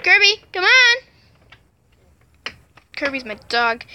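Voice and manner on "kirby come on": calling the dog. Real, not acted. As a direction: call the dog from across the house.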